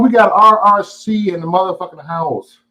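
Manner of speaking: high-pitched, drawn-out hype shout-out; excited, slow-rolling welcome; hyped greeting. Real, not acted. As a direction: welcome them in with a big, high holler. Hyped greeting as guest arrives.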